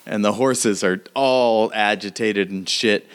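usual speaking voice

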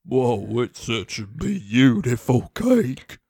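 low, slow awe; awed pony. Acted. Feuding pony admiring the cake, low awestruck voice. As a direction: voice a deep pony marvelling slowly.

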